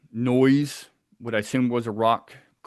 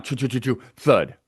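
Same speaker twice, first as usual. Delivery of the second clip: imitated falling rock; 2 semitones higher than usual. Camper mimicking a rock coming down by the tent, imitated crash. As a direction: mimic something crashing down through branches and landing hard. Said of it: "you just hear it come down".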